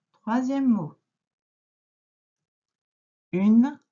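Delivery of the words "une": very slow, loud, stretched out; slow dictation. Shown as a demonstration. Teacher dictating to a pupil, slow and loud. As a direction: draw the word out so it can be written.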